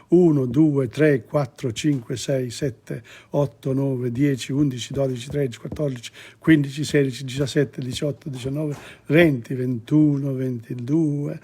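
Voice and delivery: Italian numbers counted out one by one; counting in Italian. Shown as a demonstration. Teacher counting Italian numbers for learners, steady recital. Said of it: "do you know the numbers?".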